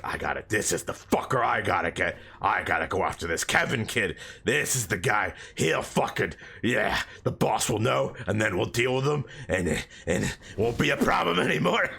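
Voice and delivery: a lightly voiced vengeful demon, low and gruff. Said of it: "he's just like".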